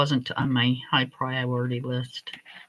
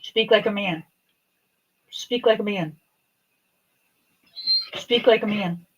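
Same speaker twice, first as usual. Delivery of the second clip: higher, playful scolding of the dog; coaching the dog; 4 semitones higher, 5 dB louder than usual. Real, not acted. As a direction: tease the dog to bark properly.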